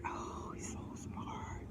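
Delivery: breathy admiring whisper. Real, not acted. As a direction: whisper it like a secret crush. Gushing about Aquarius smarts.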